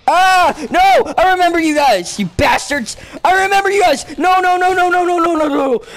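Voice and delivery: high, loud cry of dismay; panicked shouting. Real, not acted. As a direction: yell in horror as they appear. Startled by hated creatures in game.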